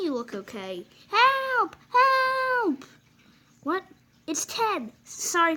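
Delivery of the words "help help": high, loud shouted help; stuck engine shouting for help; cry for help. Acted. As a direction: call out desperately. Trapped engine calling out.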